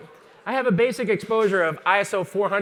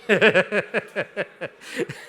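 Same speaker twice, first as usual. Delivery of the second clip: rapid staccato chuckle; real laugh in a long quick run. Real, not acted. Presenter amused by his own ugly-background setup, laughing. Laughs after setting up scene.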